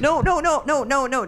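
squealing no no no, high-pitched; panicked high no. Real, not acted. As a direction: shriek the denials high and fast.

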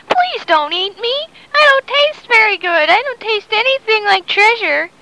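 high-pitched mock pleading; pleading captive. Captive begs Long John Silver not to eat her, high plea.

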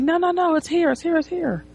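high, hurried-sounding protest, fading at the end; mock flustered. Geek stopping his visitor from leaving, mock flustered.

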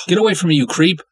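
high, loud yell at her captors; furious yell. Acted. Bound captive woman yelling at her kidnappers, furious. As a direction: shove them off with your voice in fury.

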